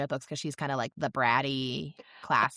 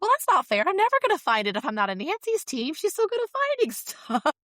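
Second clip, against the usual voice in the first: very high-pitched mock protest; high, indignant kid voice, lightly hammed-up; imitated childish complaint; 12 semitones higher, 4 dB louder than usual. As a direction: play a little kid objecting to unfair teams. Bess complains about unfair teams.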